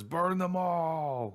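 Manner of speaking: hammed-up raving king; Mad King raving. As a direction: draw out a crazed command like the Mad King. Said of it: "and he says".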